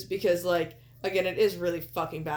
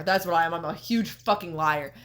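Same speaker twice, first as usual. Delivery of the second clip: ordinary delivery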